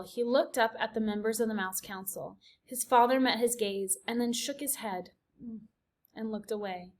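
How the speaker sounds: ordinary delivery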